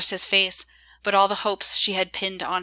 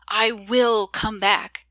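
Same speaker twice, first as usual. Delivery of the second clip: "I will come back" slow, each syllable pressed; every syllable emphasized; much slower than usual. A narrator's reading. August vowing to Joy he'll return, syllable by syllable stressed. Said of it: "emphasizing every syllable".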